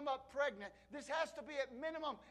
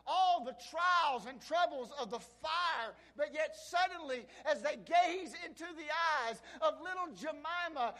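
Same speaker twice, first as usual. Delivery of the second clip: booming motivational speech; 5 semitones higher, 7 dB louder than usual. Acted. Preacher building to climax.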